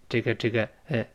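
usual speaking voice

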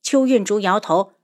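ordinary delivery, nothing marked

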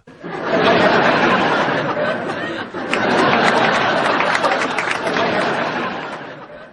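high breathy burst of laughter; big laugh. Real, not acted. Co-host laughing hard at the patient's answer. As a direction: crack up at the punchline.

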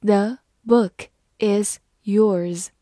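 very slow, word by word; slow reading. Shown as a demonstration. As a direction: leave a clear pause after each word. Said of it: "慢慢来读".

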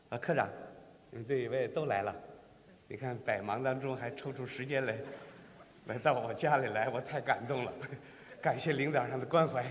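hushed tearful greetings to the bosses; mock mourning son. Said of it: "我就是这个哭老师".

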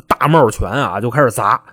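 usual speaking voice

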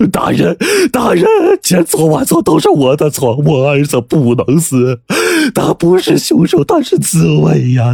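high, wailing plea; desperate begging. A narrator's reading. Squire kowtows to the prefect for his son's life, desperately pleading. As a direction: beg frantically in a high, tearful cry. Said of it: "拼命地给知府磕头".

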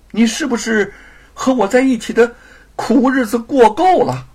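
accusing husband. Acted. Husband baits wife about hardship, needling accusation. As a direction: provoke her on purpose.

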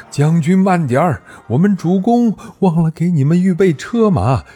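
calling out. Acted. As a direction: shout across the water. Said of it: "大声嚷道".

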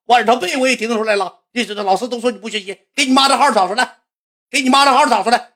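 high-pitched, worked-up orders; acted irate old man, high insistent voice; angry grandpa scolding.

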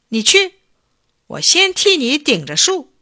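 high, loud command; urgent order. A narrator's reading. Chief takes over propping the tree, urgent.

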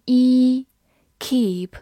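"keep" with a long, drawn-out ee vowel; long E sound. Shown as a demonstration. Teacher shows the double E, "keep" with a long ee. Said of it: "两个字母E发长音E".

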